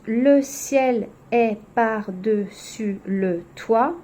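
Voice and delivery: very slow, syllable by syllable; "Le ciel est par-dessus le toit" spaced out one syllable at a time; counting syllables. Shown as a demonstration. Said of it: "Donc si on compte".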